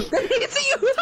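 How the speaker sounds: high laughing disbelief. Real, not acted. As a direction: laugh through the words. Teammate escaped unseen.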